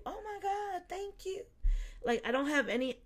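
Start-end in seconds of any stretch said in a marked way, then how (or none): 0.1-1.4 high squeal of surprised gratitude; delighted surprise. Retold or imitated. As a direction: gasp it out, much higher, thrilled. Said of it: "I was like".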